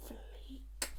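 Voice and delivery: breathy whisper on the last word. Real, not acted. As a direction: drop to a whisper. Whispered punchline.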